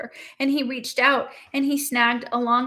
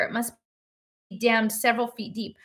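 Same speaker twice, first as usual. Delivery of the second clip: ordinary delivery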